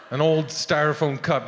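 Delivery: ordinary delivery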